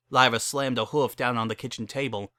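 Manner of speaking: ordinary delivery, nothing marked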